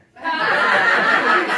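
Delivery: loud burst of real laughter; big laugh. Real, not acted. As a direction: laugh out loud at your own punchline. Punchline about mother assaulting her.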